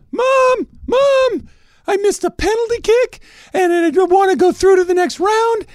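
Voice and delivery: put-on very high and loud, a wailing kid begging his mom; whiny crying child Messi. Messi wailing to his mom after missing a penalty, very high and loud. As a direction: whine it up high like a sobbing little boy. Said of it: "The best is the mom".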